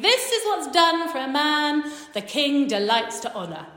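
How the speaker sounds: loud, high herald's proclamation; proclaiming. Retold or imitated. Nobleman proclaiming an honour through the streets, proclaiming.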